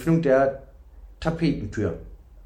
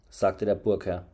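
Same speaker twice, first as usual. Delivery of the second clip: ordinary delivery, nothing marked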